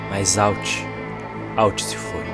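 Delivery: ordinary talk, nothing marked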